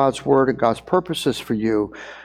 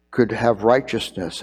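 normal talking voice

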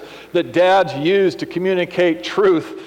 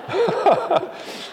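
high giggle. Real, not acted. Laughing at own dad quote.

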